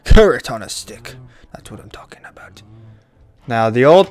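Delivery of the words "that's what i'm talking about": triumphant whisper. Real, not acted. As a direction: hiss it with quiet glee. Triumphant after success.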